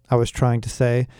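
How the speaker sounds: usual speaking voice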